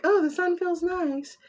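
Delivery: high, put-on voice savoring the sun; mock dreamy. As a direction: play someone blissfully basking. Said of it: "it's like".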